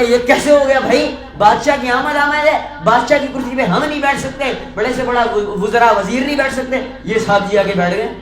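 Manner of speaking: loud, high outraged protest; outraged courtiers. Acted. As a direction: shout it like scandalised courtiers. Outraged courtiers shout at beggar. (درباری غصے سے چیختے ہوئے)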